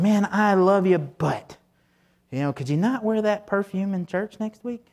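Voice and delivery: sweet on top, a mild jab underneath; backhanded criticism. Retold or imitated. Pastor voicing a churchgoer criticizing a neighbor's perfume, mock sweet. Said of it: "instead of going and saying".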